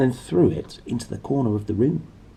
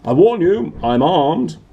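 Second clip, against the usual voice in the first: higher, loud blustering warning; threatening shout; 7 semitones higher, 7 dB louder than usual. Acted. Uncle Vernon warning the intruder, loud and threatening. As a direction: bluff hard, you have a gun.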